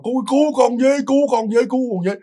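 pitched well up, pleading cry for help, not full volume; imitated panicked cry for help. Khánh waking, begging his parents for help, high panicked plea. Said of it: "ông la sùm trời đất".

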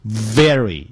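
"very" with a clear buzzing v; firm v at the start of "very"; correct V pronunciation. Shown as a demonstration. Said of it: "要念成".

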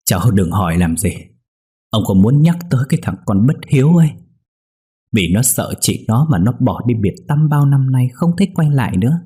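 put-on old man pressing his words out, a touch harsh; mildly harsh old man refusing to talk about his son; stern harsh tone. A narrator's reading. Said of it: "Ông cụ gằn giọng".

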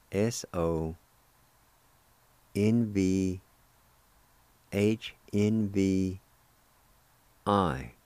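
careful, slow spelling for learners; slow letter-by-letter spelling. Shown as a demonstration. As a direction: spell each letter slowly, with long gaps. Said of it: "Just spell like this".

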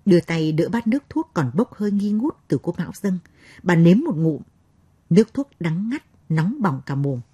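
usual speaking voice

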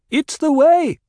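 a voice in the crowd shouting back. A narrator's reading. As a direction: call it out across the cavern. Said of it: "someone shouted".

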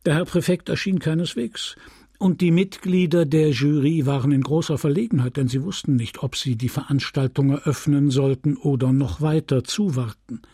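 ordinary delivery

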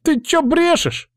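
very high, drawn-out outraged woman; slow, high-pitched furious slattern; enraged. A narrator's reading. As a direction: screech indignantly at a slander. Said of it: "взъярилась шалава".

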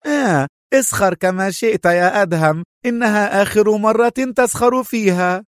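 high, gloating triumph; overjoyed cry. Acted. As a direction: exult over a beaten rival. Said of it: "وصاحت في فرح".

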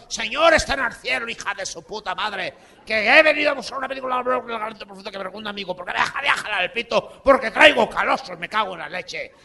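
high, loud, fast rant; high-pitched fast tirade; angry rant. Retold or imitated. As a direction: berate the clerk without a breath. Uncle ranting at clerk.

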